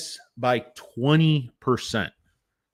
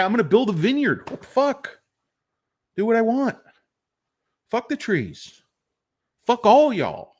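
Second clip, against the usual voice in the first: a lightly voiced brash CEO, higher pitched; Uncle Chucky; 8 semitones higher than usual. Imaginary CEO brushing off critics, lightly voiced brash defiance. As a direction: play the defiant uncle with a hint of swagger. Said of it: "That's a direct quote from Uncle Chucky".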